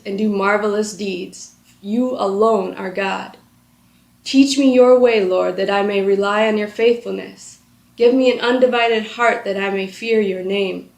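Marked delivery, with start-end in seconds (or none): none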